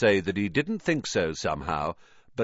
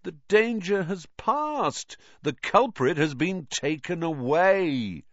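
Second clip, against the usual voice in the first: slow, patronizing drawl to the dim; speaking slowly; 9 semitones higher than usual. A narrator's reading. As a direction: talk down to them, every word spelled out. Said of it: "speaking slowly, as though they were all a bit dim".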